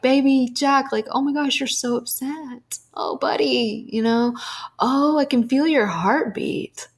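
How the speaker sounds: put-on calm a sobbing little boy; retold tender voice to a toddler; soothing a toddler. Comforting upset toddler nephew.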